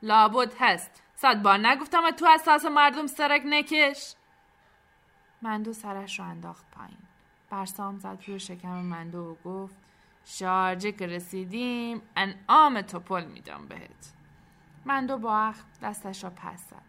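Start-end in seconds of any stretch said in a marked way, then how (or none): 0.0-4.1 very loud, raised telling-off; captain's shouted scolding. A narrator's reading. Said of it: "ناخدا داد زد". 10.2-14.1 promising character voice. A narrator's reading. Barsam promises Mando a tip.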